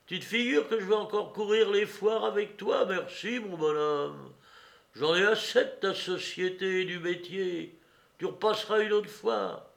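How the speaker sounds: high, loud mocking refusal; scornful, brazen woman. A narrator's reading. Woman tells old partner she's done, scornful. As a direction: laugh him off with contempt.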